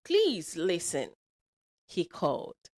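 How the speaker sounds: put-on animated, lilting storyteller for kids; lively story read to children; children's storytelling. A narrator's reading. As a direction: read it aloud with big rises and falls. Tells a children's story with character voices.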